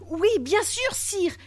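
high-pitched, eager relief; greatly relieved. A narrator's reading. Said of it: "grandement soulagé". (grandement soulagé, voix haut perchée)

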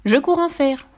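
volunteer eagerly, pitch lifted; exclaiming. A narrator's reading. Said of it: "s'exclama Fifi". (exclamation pleine d'entrain)

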